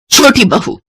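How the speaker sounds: sharp, angry summons of the daughter-in-law; angry call. Acted. Humiliated mother-in-law angrily calls for her daughter-in-law. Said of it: "जोर से चिल्लाते हुए कहती है".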